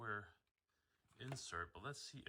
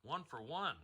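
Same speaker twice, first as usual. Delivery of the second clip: high, loud excited reaction; 5 semitones higher, 6 dB louder, slower than usual. Real, not acted. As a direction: cheer the result. Reacting to the fight result.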